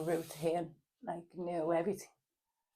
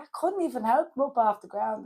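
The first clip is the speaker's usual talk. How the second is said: high, loud mock protest; retold protest; 6 semitones higher, 7 dB louder than usual. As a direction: protest it back to mum, retold.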